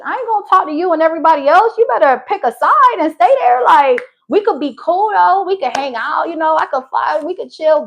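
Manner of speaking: high, loud, sassy telling-off; acted ultimatum. Woman telling her friend to pick a side, loud sassy ultimatum.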